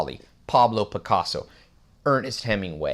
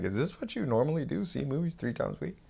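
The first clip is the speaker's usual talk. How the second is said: higher, softer questioning voice; quiet, raised-pitch mimicry of a girlfriend; imitated girlfriend; 5 semitones higher, 7 dB quieter than usual. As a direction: lightly voice a puzzled date, pitched up. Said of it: "And she's like".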